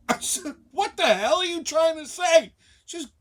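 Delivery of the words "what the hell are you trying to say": exasperated. Retold or imitated. Exasperated questioning of the bartender.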